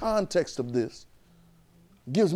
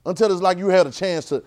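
preaching; 5 semitones higher, 4 dB louder than usual. Acted. Preaching at raised pitch.

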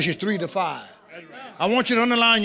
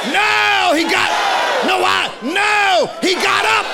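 high, shouted pulpit cry with stretched words; full-force preaching; 12 semitones higher, 4 dB louder, slower than usual. Real, not acted. Preacher challenging Satan before the congregation, full-force shout. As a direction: preach it loud, voice pitched way up.

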